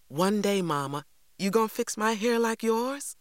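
put-on high child's voice, rising question; hopeful little girl. Girl asks mother to do her hair someday, hopeful.

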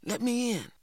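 high-pitched, pleading call at the door; secretive wake-up call, still fully voiced; soft urgent call. A narrator's reading. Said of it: "calling softly".

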